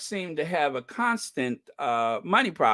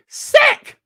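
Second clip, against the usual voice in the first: sudden high, loud yell; real furious shout of disgust; outraged shout; 11 dB louder than usual. Real, not acted. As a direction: scream it out in disgust. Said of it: "Do you hear me?".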